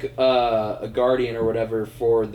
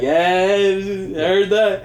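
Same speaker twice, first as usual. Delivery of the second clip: higher, slow, louder mock answer; gruff celebrity reply; 8 semitones higher, 7 dB louder, slower than usual. Michael Rooker answering the pretzel joke, imitated slowly. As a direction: drag it out like the amused actor. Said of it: "He's like".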